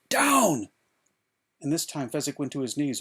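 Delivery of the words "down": high, loud forceful holler. A narrator's reading. Inigo shoving the giant down, hollering. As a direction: slam the single word out.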